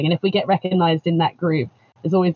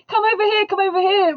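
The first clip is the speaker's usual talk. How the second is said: high, eager call; high, excited call to the group; calling friends over; 13 semitones higher than usual. Retold or imitated. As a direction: wave the whole group in. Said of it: "It's like, it's like".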